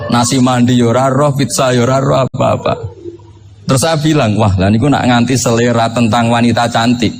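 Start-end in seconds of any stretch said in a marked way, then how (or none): none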